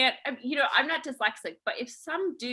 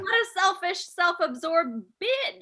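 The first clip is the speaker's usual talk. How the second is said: mock outrage; 7 semitones higher than usual. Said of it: "Just kidding".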